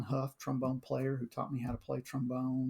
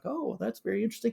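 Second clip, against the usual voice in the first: awkward polite reply; 8 semitones higher, faster than usual. Retold or imitated. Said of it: "I was like".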